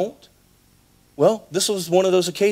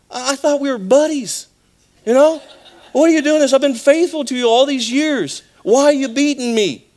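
hurt talking donkey; 7 semitones higher than usual. Retold or imitated. Donkey pleads with its master Balaam, high and hurt. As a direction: plead like a wounded, loyal donkey. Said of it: "and something like this".

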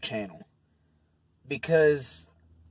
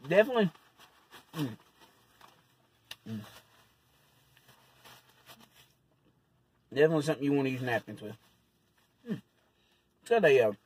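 chewing while talking; much faster than usual. Real, not acted. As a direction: mouth partly full. Seen 0: bites burger at -5; lips closed chewing; napkin wipes mouth 3.3–5.8.

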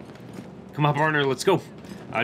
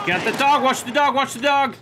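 warning shout; 9 semitones higher, 3 dB louder than usual. Pretend. Warning officers about dog.

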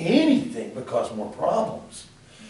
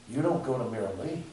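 ordinary delivery

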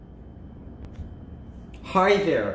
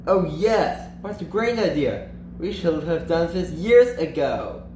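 excited; 2 semitones higher than usual. Acted. Party guests welcome the idea of switching places, excited. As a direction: exclaim with rising enthusiasm, pitch lifted. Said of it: "A wave of excitement".

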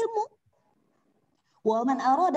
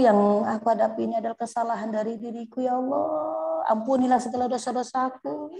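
prayer. Acted. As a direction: beg forgiveness humbly, drawing out ya Allah. Models a repentance prayer.

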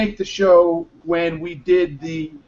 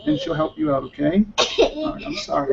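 consoling father; 11 semitones higher than usual. Real, not acted. Father soothing crying daughter.

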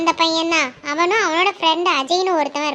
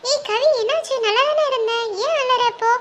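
much higher female voice, anxious; worried girlfriend; 7 semitones higher than usual. Acted. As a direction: ask her friend what happened, pitched up. Friend Asika worried for Kavi. (உயர்ந்த குரலில் கவலைப்படும் தோழி)

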